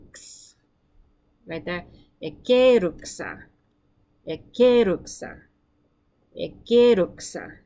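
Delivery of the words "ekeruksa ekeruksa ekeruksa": ekeruksa said slowly and loudly for repeating; slow loud model. Shown as a demonstration. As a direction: model ekeruksa clearly for the class. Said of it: "Your turn".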